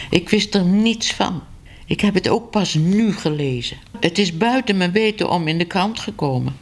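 a flustered editor pleading innocence; nervous editor. Acted. Editor nervously excusing himself to Ellemeet. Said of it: "zenuwachtig op zijn nagels".

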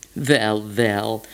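put-on low, pitying sigh of an old stray cat; a touch of weary sympathy. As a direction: cluck over it with mild pity. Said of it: "zei de jakkepoes medelijdend".

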